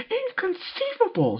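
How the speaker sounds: high, slow, drawn-out squeal; dramatic cry. Acted. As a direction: stretch the word theatrically. Pinkie popping up dramatically.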